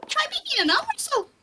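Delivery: quick, extremely high squeak; tiny squeaky dwarf, very high and fast. Acted. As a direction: push right up into top falsetto. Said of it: "trying to keep that voice up higher".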